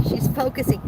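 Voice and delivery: ordinary talk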